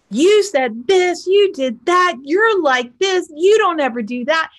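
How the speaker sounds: high-pitched, emphatic finger-pointing tirade; heated accusations. Acted. Partner listing grievances in a pissing match, heated accusations. Acted-out argument trading accusations.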